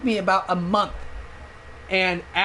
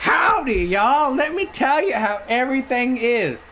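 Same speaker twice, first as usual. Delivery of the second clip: high, mock Texan howdy; bad Texan accent; 5 semitones higher than usual. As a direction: put on a hammed-up Texan accent. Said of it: "In, like, a Texan accent".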